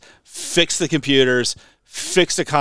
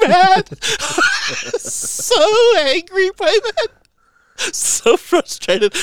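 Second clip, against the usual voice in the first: much higher, very slow words squeezed out through real laughter; laughing; 4 dB louder, much slower than usual. Real, not acted. Caller recalling his fury, laughing as he tells it.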